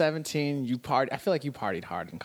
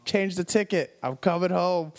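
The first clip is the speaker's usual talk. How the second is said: urgent plea home; 5 semitones higher, 3 dB louder than usual. Retold or imitated. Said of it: "I told my mom".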